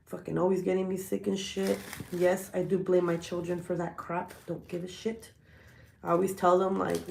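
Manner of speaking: usual speaking voice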